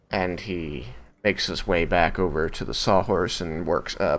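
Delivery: ordinary talk, nothing marked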